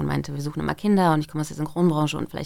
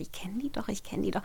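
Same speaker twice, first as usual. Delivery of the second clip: hushed thought; 3 semitones higher, 10 dB quieter than usual. Retold or imitated. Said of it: "und dachte".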